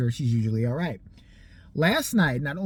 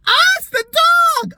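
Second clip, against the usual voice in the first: loud, much higher cry of discovery; startled yell of "it's the dog"; sudden realisation shout; 11 dB louder than usual. Retold or imitated. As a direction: blurt it out as the penny drops. Steve suddenly realising blood source.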